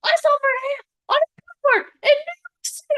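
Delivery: high squealing excited caller. Retold or imitated. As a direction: squeal the news like a thrilled friend. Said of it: "got a call from someone".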